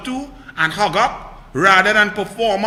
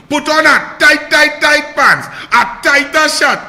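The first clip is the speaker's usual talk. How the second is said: higher, louder, mocking tirade; scornful shout; 4 semitones higher, 6 dB louder than usual. Real, not acted. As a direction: rage and ridicule at once. Ranting about attorney general.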